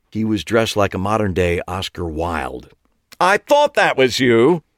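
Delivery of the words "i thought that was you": loud, pitched-up greeting; too loud. A narrator's reading. Clive greeting Eli too loudly in the hospital lobby. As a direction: boom it across the lobby. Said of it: "in a voice way too loud for the setting".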